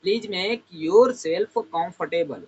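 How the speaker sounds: very slow, each word drawn out; slow model sentence. Shown as a demonstration. Teacher slowly models offering someone a seat. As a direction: stretch every word for the class. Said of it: "बोलेंगे".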